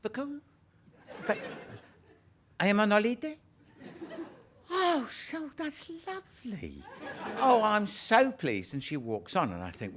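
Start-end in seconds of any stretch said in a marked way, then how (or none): 0.0-3.4 put-on much higher puzzled old lady; old lady puzzles over Poirot's French. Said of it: "She says". 4.7-8.7 much higher, slow and delighted; old lady is thrilled by Poirot's holiday. Retold or imitated. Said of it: "She says".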